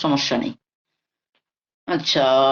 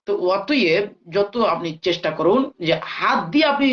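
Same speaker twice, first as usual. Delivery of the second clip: ordinary talk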